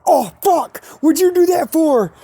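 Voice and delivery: high, loud yelp of pain and outrage, retold; mock cry of a man just hit by a rocket; pained shout. Coach hit by a bottle rocket, yelling high and loud in pain. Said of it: "we hear the P E coach go".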